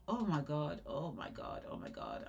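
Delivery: breathless whisper of shock; whispered oh my God. Real, not acted. Reacting to painful braids, a shocked whisper. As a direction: whisper it in horror. Horrified at tight braids video.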